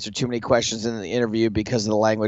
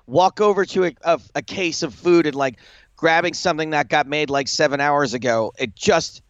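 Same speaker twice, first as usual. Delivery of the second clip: normal talking voice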